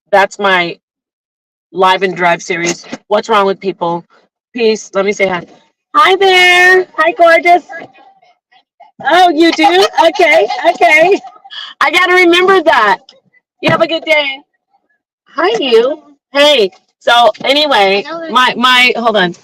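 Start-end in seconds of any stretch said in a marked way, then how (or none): 5.9-16.7 real high-pitched, sing-song hello; friendly greeting. Real, not acted. Live streamer saying hi to someone nearby, high friendly greeting. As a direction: greet them brightly in a much higher voice.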